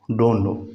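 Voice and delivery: "डोंट नो" run together as "dunno"; American-style "डोंट नो", no T; American silent T. Shown as a demonstration. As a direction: drop the T in "डोंट". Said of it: "इस टी को silent कर देते हैं".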